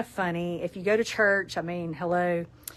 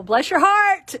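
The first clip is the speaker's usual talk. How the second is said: sweet Southern jab; 10 semitones higher, 9 dB louder, slower than usual. Acted. Southern saying on towel.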